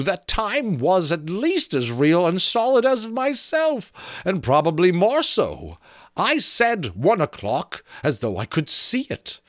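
high, lilting skull voice, drawing out the years; a skull remembering life; wistful talking skull. Acted. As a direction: muse on time like a dead man who learned too late. Skull philosophizing at length.

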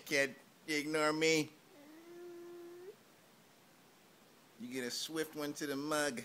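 loud, high hammed-up gangster shouting; mock tough guy. Mock threatening the baby in a gangster voice. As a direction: bark at the kid like a cartoon mobster.

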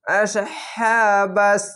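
আসহাবাস with a thick squeezed ain, slowly chanted; heavy throat letter. Shown as a demonstration. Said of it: "গলা চিপে মোটা করে পড়বেন".

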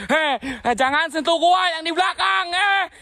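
high-pitched startled protest; alarmed cry. Real, not acted. Ghost hunter to whatever touched him from behind, alarmed high cry.

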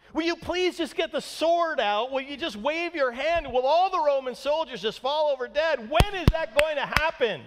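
high, loud impatient pleading; fed-up onlookers clamouring for a fight; exasperated demand. Acted. Voicing the impatient crowd pleading with Jesus.

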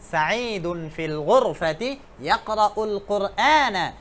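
slow, even Arabic read-out; slow careful recitation. Shown as a demonstration. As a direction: spell it out slowly for learners. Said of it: "মা বলছে".

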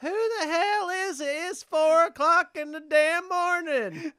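screechy high, loud hillbilly drawl; hillbilly skit voice. Acted. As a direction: holler like a hammed-up hillbilly woken at night. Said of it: "how much I sound like a hillbilly".

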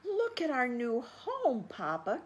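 put-on high, loud, delighted children's call; thrilled little ones showing off; excited cry. Baby possums show Papa their new burrow, high and excited. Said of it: "cried the little possums".

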